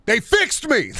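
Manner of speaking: high-pitched excited yelp; emphatic outburst. Pretend. Streamer insisting the Mister Men books fixed him, emphatic outburst. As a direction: suddenly jump high and cry it out. Repeats his own line louder.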